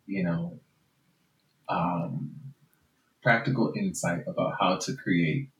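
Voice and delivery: ordinary delivery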